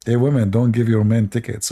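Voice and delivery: ordinary talk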